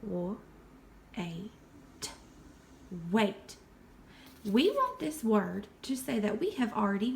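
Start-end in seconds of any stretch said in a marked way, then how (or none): 0.0-3.6 "Wa-, a-, t-" said one sound at a time, then blended. Shown as a demonstration. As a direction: tap out "wait" sound by sound. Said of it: "let's finger tap our word".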